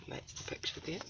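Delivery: whispered aside about the picture. Real, not acted. Storyteller whispers to viewers about the picture. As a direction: breathe it out in a whisper. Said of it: "unvoiced whisper".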